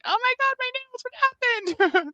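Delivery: high, slow, panicked squeal; hammed-up horror, way up high; mock panic over poisoned nails; panicked self. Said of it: "I was like".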